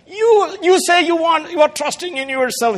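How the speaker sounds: high, loud sneering taunt; mocking commander. Assyrian commander mocking the people's trust, high sneer. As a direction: jeer at their trust in themselves. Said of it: "He's mocking".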